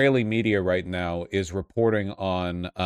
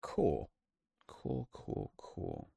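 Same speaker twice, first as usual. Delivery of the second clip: much softer, trailing into a breathy whisper; sarcastic resigned murmur, fading out; dry sarcastic whisper; deadpan cool; 2 semitones lower, 14 dB quieter, much slower than usual. Real, not acted. Deadpan reaction to evasive answer.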